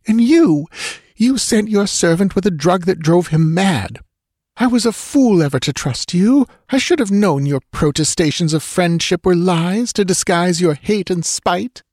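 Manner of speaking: high, emphatic accusation; bitter. A narrator's reading. Dancer accuses the priest of betrayal, bitter. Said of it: "she said bitterly".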